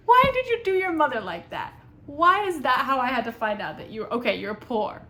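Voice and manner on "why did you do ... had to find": louder, higher disbelieving cry; exasperated outburst. Real, not acted. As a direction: throw it at the book in disbelief. Mocking the narrator's wording.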